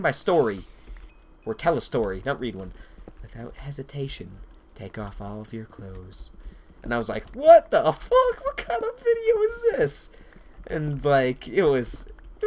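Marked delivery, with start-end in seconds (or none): 3.2-6.3 erotic audiobook. Retold or imitated. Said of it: "It sounded like an erotic novel". 7.3-10.1 high, loud mock disbelief; hammed-up, high-pitched incredulous yell; shocked outburst. Acting out his shocked reaction.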